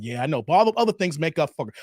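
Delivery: usual speaking voice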